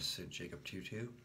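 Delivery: plain reading voice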